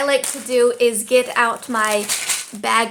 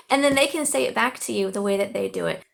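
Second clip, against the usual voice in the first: ordinary talk, nothing marked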